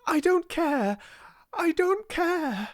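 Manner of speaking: very high, impassioned outburst; excited cry. A narrator's reading. Girl refusing to let the dream go, excited cry. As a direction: cry out defiantly, pitch right up. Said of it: "she cried".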